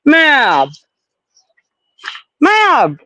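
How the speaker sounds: rising sing-song call of "Mav". Real, not acted. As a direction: call the dog to come back. Calling the dog.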